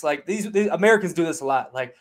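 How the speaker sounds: ordinary talk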